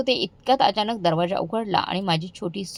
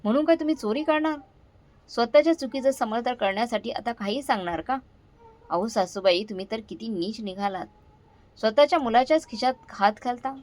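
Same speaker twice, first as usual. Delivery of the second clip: ordinary delivery, nothing marked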